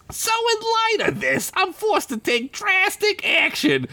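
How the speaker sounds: high squeaky gangster voice; menacing Crinkles. Acted. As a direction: lean in like a tiny crime boss. Crinkles threatens.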